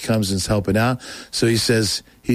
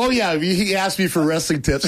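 laughing; 8 semitones higher than usual. Retold or imitated.

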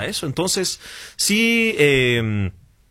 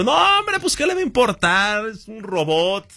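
high, breezy brush-off to his worried dad; dismissive reassurance; 7 semitones higher than usual. Retold or imitated. Son brushing off his father's embarrassment, high and breezy. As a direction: wave off the worry, it's only a robot.

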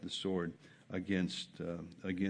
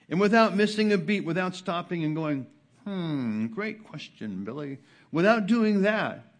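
loud, high preacher telling the story; fervent pulpit storytelling; preaching at full force; 7 semitones higher, 9 dB louder than usual. Acted. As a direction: drive the anecdote home from the pulpit.

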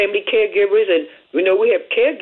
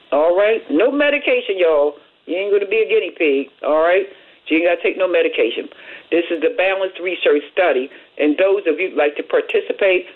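usual speaking voice

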